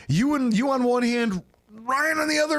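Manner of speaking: usual speaking voice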